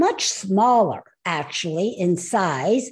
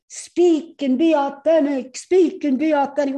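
higher-pitched mock slogan voice; repetitive, higher sing-song quote; quoted slogan; 4 semitones higher than usual. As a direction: parrot an overused catchphrase, pitched up. Quoting an often-heard slogan.

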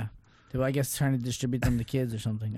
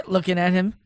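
normal talking voice

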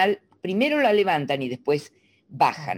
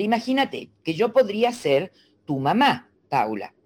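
normal talking voice

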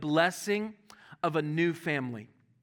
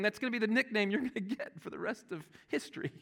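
ordinary talk

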